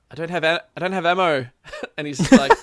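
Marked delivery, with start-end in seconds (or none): 0.1-1.5 raised, higher voice, mock urgent; retold calling out. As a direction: call to a squadmate that you're out.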